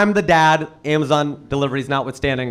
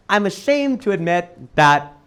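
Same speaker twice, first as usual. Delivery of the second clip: ordinary delivery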